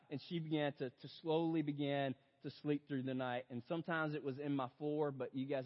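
usual speaking voice

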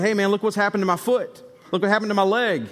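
high-pitched, eager imitation of showing off a miracle; retold excitement. Preacher voices healed man telling his community, excitedly.

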